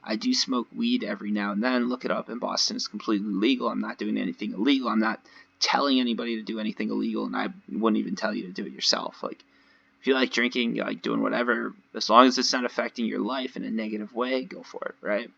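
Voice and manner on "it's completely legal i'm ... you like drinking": normal talking voice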